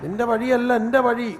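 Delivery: loud, measured declamation of scripture; forceful pulpit declaration; emphatic preaching. Acted. Preacher repeats God's words.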